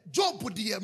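normal talking voice

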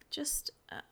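breathy tearful whisper. Acted. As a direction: whisper it through held-back tears. Tearful girl giving directions.

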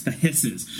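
ordinary talk

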